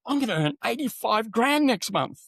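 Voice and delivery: blurting out a wild earnings forecast; excited disbelief. Retold or imitated. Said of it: "I'd be going".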